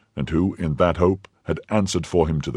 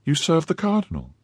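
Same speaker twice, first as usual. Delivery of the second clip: put-on higher young woman's voice, startled; young wife voice; 12 semitones higher than usual. A narrator's reading. Madame Bonacieux voiced in story.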